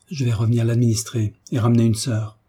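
usual speaking voice

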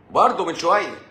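"برضه" pitched high and loud; loud, high first word; emphatic exclamation. Real, not acted. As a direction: hit "برضه" hard, then fall. Repeats the phrase in contrasting ways.

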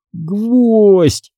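distressed repetition. A narrator's reading. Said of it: "повторял Карло как заведённый".

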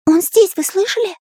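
high, thrilled character voice; delighted. A narrator's reading. Artist hears the spirit answer, delighted high voice. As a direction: burst out in high-pitched glee. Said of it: "обрадовался Карандаш".

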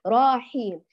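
راحيم with the raa held too long; inserted alif. Shown as a demonstration. Teacher repeats the mistake for students, stretched ra. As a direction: lengthen the aa in راحيم. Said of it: "أدخلت ألفًا بين الراء والحاء".

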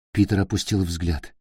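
ordinary delivery, nothing marked

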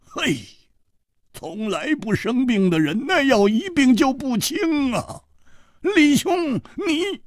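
high, strained, frantic voice; agitated hoarse cry; hoarse with worry. A narrator's reading. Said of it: "他哑着声音说".

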